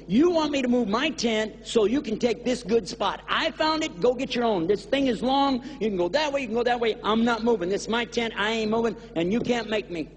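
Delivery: a stubborn man guarding his spot; stubborn indignation. Acted. Man refusing to leave the tracks, petulant.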